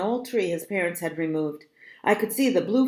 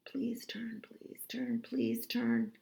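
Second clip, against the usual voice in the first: urgent whisper under the breath; hushed, higher, pleading whisper; whispered plea; 4 semitones higher, 12 dB quieter, slower than usual. A narrator's reading. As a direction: whisper it like a desperate prayer. Silent plea while fleeing in fear.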